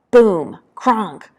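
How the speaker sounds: sudden impact words, hit hard; explosion noises. Acted. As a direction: read out the blasts as sharp bursts. Imitated battlefield explosion noises.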